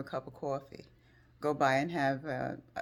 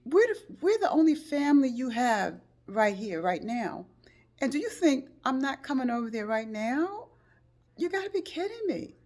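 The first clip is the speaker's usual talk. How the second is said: high, loud indignant voice; indignant disbelief; 10 semitones higher, 6 dB louder than usual. Retold or imitated. Speaker insisting to injured friend, indignant. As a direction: insist you're coming no matter what. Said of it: "I said".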